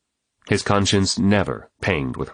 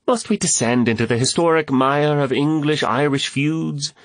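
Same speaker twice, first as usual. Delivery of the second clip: higher-pitched theatrical complaint; mild protest; 9 semitones higher than usual. A narrator's reading. Woman objects to an old feud, mildly protesting. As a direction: protest with a lifted questioning pitch. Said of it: "Catherine protested".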